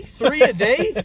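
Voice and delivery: high, loud, slowed "Three a day"; mocking echo of mom's demand; stunned disbelief. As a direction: drag it out in disbelief. Incredulous repeat of mom's words.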